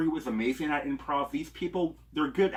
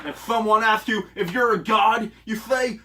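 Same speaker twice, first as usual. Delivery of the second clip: high, booming heroic declaration; Thor quote shout; 8 semitones higher, 8 dB louder than usual. Pretend. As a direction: proclaim it like a god of thunder.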